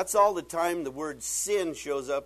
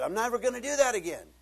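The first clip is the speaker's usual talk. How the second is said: higher, louder, faster promise; mock vow repeated; 7 semitones higher, 5 dB louder than usual. Preacher voicing the man's repeated vow to quit, fast and loud. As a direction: rattle off the same empty vow. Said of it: "And you said".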